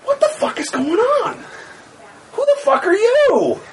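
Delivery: high, laughing shriek; shrieking confusion. Acted. GM voices the baffled woman, high laughing shriek. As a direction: squeal it out in disbelief.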